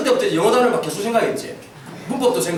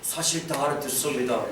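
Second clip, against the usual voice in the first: much lower and softer admission; sheepish confession; 11 semitones lower, 9 dB quieter than usual. Retold or imitated. Teens admitting to the pastor they understood, sheepish and quiet. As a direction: own up quietly. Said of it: "막 이러는 거야".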